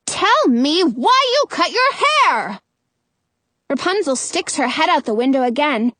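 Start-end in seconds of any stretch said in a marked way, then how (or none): 0.1-2.6 slow, high, demanding yell; high-pitched witch's demand. A narrator's reading. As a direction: demand it from below, word by word. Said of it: "Frau Gothel yells".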